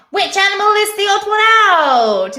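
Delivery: high, loud quiz-show call; hammed-up quiz host. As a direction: belt out the question like a game-show host. Host calls out next quiz question.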